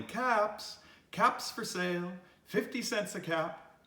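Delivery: high street-vendor call; peddler calling. A narrator's reading. Peddler calling caps for sale along the street, calling out. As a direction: call out wares in a lifted singsong voice. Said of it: "walked down the street calling".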